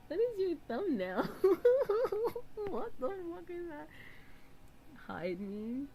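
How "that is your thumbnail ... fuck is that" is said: much higher and louder, giggling throughout; laughing disbelief. Real, not acted. Streamer still cracking up at the thumbnail.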